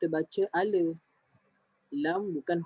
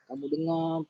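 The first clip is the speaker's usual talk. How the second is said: ordinary talk, nothing marked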